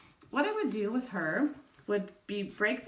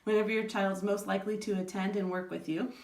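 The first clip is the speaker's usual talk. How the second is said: ordinary talk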